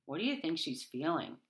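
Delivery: ordinary delivery, nothing marked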